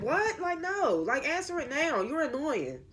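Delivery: high-pitched, incredulous objection; exasperated outburst. Retold or imitated. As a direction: protest in high-pitched annoyance at her evasiveness. Acts out own annoyed reaction to character.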